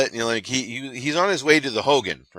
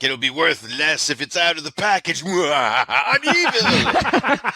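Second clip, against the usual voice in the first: mock evil villain; 6 semitones higher, 3 dB louder, slower than usual. Acting out gleeful villain's reasoning.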